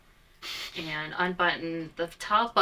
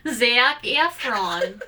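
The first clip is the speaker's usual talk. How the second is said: high, loud, slow swoon over "Zac Efron"; swooning fangirl; 5 semitones higher, 10 dB louder, much slower than usual. Pretend. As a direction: drawl the name like a smitten fan.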